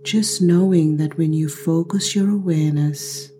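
slow, unhurried meditation voice with drawn-out words; guided meditation. Acted. Guide leading listeners to slow the heart, guided meditation. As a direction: stretch the key words, leaving space between phrases.

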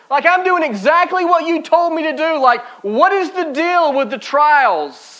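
acted frustration, stressed and stretched key words; exasperated complaint. Preacher voices an obedient believer questioning God, exasperated.

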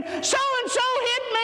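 put-on high whiny child's cry; mock child wailing to a parent; tattling child. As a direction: play the kid running in to tattle.

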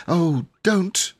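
put-on high, begging girl's voice; pleading little girl. A narrator's reading. Jane begging her brother to stop, high and pleading. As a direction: plead like a small girl who's had enough. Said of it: "said Jane".

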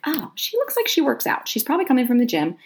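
lifted, gushing inner monologue; eager inner thought. Retold or imitated. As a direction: size up a new friend with bright hope. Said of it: "seeing her and thinking".

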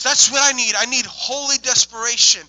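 impassioned retold plea; desperate plea. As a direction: cry it out with urgent need. Retells man's desperate plea.

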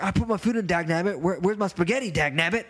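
mock grumble with a folksy catchphrase; coworker's catchphrase voice. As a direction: mimic him complaining in folksy style. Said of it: "And that's how he talks".

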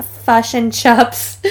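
slow, exaggerated Kiwi accent; drawn-out Kiwi vowels, sent up; strong Kiwi accent. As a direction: imitate your thick childhood Kiwi accent. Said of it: "I had the strongest Kiwi accent".

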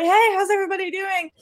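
high-pitched imitation of a front man hyping the room; mock stage shout. Front man greeting the audience, mock stage shout. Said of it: "so he shouted out".

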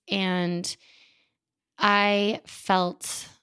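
ordinary talk

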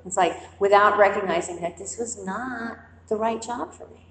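ordinary talk

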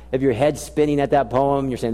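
ordinary talk

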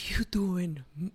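imitated gasp, then breathless whisper; shocked gasp. Retelling a shocked mother-in-law.

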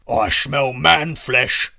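loud, drawn-out giant roar; booming giant. Acted. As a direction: bellow it slowly as a hungry giant. Giant's greeting on arriving home.

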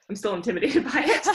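laughing it out mid-sentence. Real, not acted. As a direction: laugh while admitting the fear. Laughs at own admission.

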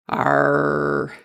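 low, drawn-out pirate "arr"; pirate growl. A narrator's reading. Narrator plays the Pirate Pups, pirate growl.

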